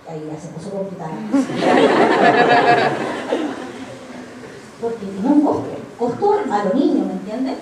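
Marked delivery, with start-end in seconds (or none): 1.2-4.8 long laugh. Real, not acted. Laughs at the complication.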